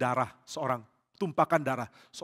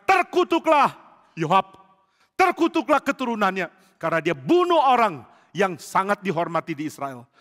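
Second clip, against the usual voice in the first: a loud, much higher, raging curse; furious curse; 4 semitones higher, 8 dB louder than usual. Acted. David curses Joab before all his gathered soldiers, furious. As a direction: curse him before the whole army. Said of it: "lalu dia marah".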